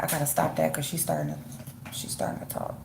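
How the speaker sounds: quiet. Real, not acted. Stops cursing near baby.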